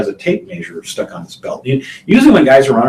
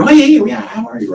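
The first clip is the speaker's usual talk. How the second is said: much higher, gushing yeah yeah yeah; eager greeting; 13 semitones higher, 3 dB louder than usual. Retold or imitated. Agent greets loyal buyer Roger on the phone, high and gushing. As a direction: greet the favourite client like an old pal.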